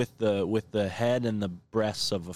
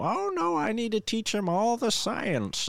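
very high squeaky little-man voice; a tiny eager professor piping up; old gnome scientist; 13 semitones higher than usual. Retold or imitated. Gnome scientist insists on teaching the boy science, very high squeaky voice. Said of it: "scientist boy, guy is like".

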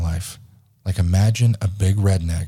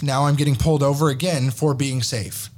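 ordinary delivery

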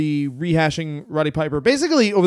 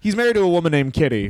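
usual speaking voice

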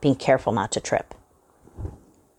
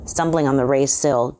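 ordinary delivery

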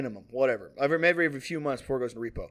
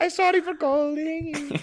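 very high, slow, pitiful caller voice; mock crying; 11 semitones higher, slower than usual. Swedish caller apologising to the debtor, hammed-up tearful high voice. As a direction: drag it out in a fake tearful whine. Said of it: "And then he starts crying".

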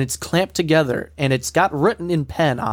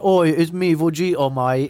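put-on botched Jamaican accent, more like Australian; bad Jamaican accent. Host mocking friend's Vegeta, badly faked Jamaican accent. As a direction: attempt a Jamaican accent and miss it. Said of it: "He does a Jamaican accent for Vegeta".

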